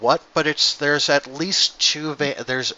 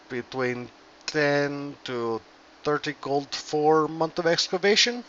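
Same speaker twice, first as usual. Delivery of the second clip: put-on Russian dwarf accent; 4 dB quieter than usual. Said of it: "we're Russian".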